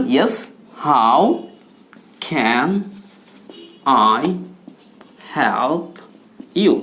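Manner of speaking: "Yes, how can I help you" with a long pause after each word. Shown as a demonstration. Teacher dictates the reply word by word, slowly. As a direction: dictate it one word at a time. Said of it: "কি বলবেন".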